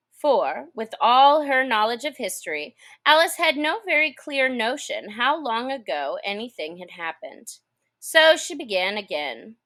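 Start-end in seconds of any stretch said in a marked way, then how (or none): none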